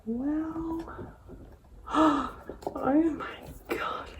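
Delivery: slow whispered awe; hushed, almost speechless; breathless whisper of wonder; awed whisper. Real, not acted. Opening the big palette.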